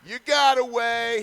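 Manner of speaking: high, loud drawn-out cheer. Real, not acted. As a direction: shout it out slowly. Cheering the player.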